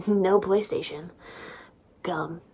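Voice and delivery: ordinary talk, nothing marked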